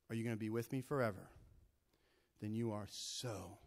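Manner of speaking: low, soft, reassuring questions; calm him down tenderly; God quietly telling Abraham he is enough; gentle God. Acted.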